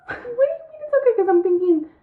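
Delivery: sharp high disbelief; incredulous. Retold or imitated. To her boss, a high incredulous squeak. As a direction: squeak it out in shock.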